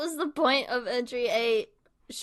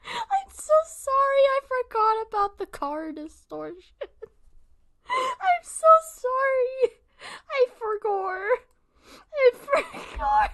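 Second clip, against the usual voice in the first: slow, very high laughing wail; crying with laughter; 14 semitones higher, 5 dB louder than usual. Real, not acted. Streamer apologising for forgotten car distortion, crying laughing. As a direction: squeal the apology, helpless with laughter. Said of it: "I'm crying though".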